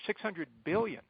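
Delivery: ordinary talk, nothing marked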